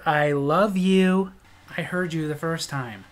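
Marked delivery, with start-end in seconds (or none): 0.0-1.3 wife repeats it very slowly; slow drawn out. Retold or imitated. Wife repeating herself, slow and drawn out. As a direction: stretch each word out. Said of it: "I said".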